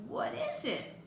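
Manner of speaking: put-on a tiny curious kid at the door; little boy voice.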